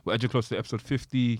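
normal talking voice

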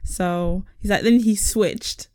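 normal talking voice

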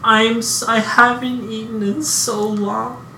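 put-on weak little girl begging for food. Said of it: "a little girl's voice".

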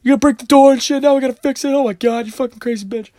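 high-pitched exasperated rant; mock outraged yell, easing in volume; imitated yelling. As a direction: mimic a man yelling at his partner. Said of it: "then he's yelling at her".

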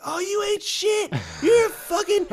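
high, loud mock screaming woman; imitating screaming neighbor. Neighbor woman screaming at her boyfriend, mock high yell. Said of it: "the woman is always just screaming at her fucking boyfriend".

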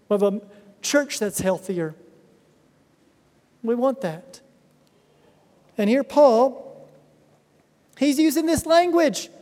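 usual speaking voice

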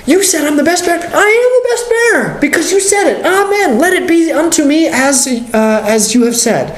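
very high-pitched, exultant declaration; bold amen. Acted. Believer answering the Lord's word, bold and exultant. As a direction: proclaim it as overjoyed good news, voice soaring then easing down. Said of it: "say it loudly and boldly".

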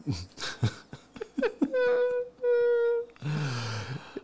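high, breathless laughing fit; laughing hard. Real, not acted. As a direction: laugh until it hurts.

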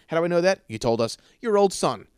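ordinary talk

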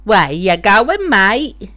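slow Aussie twang, rising on mate; Australian accent. Pretend. Boyfriend guessing the answer to a word game, mock Australian accent. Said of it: "said it in this weird accent".

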